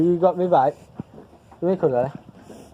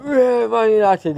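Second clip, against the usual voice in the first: mock cheer; 7 semitones higher, 4 dB louder, slower than usual. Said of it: "I went".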